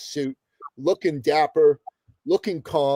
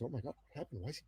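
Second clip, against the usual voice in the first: imitated worry, dropped to a near whisper; very soft, hushed mock alarm; worried mother; 22 dB quieter, much faster than usual. Mother alarmed by her suddenly silent son, retold in hushed worry. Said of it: "to the point where she got worried".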